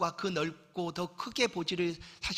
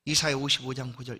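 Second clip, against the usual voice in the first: normal talking voice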